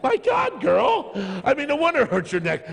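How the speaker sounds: very high, loud exclamation; comic yell of astonishment; amazed outcry. Retold or imitated. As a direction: shout it in disbelief at her. Reacting to tense woman on ride.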